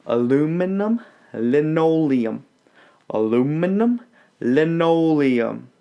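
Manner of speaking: "Aluminum linoleum" drawn out slowly, every consonant crisp; slowly and clearly, as a diction drill; teacher modelling "Aluminum linoleum" slowly; slow careful diction. Shown as a demonstration. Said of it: "Do it with me now".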